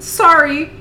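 put-on high, loud, piping Capitol accent; silly Capitol accent. A narrator's reading. Venia apologizing while waxing a leg, silly piping Capitol accent. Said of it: "pipes in her silly capital accent".

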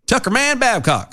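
high-pitched, playful Tucker Man Babcock; silly name voice. Pretend. Host riffing on the candidate's name, silly name voice. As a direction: announce the name in a high joke voice.

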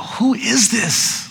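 slow, high, hushed wonder; awed question. Retold or imitated. Disciples asking each other, awed and hushed. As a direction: ask it in stunned awe. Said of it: "they looked at themselves and they go".

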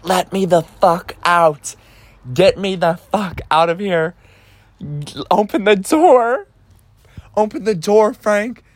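high-pitched retold hollering, climbing as it goes; imitated screaming. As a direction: imitate a friend yelling to be let out. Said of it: "She's screaming at me now".

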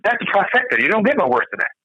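usual speaking voice

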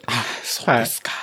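lightly voiced serious agent; customer service voice. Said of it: "その真面目トーンで言うんですね".

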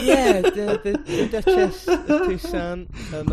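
can't get it out for laughing. Real, not acted. Recounting the twist to Adam, laughing.

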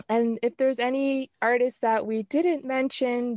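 ordinary talk, nothing marked

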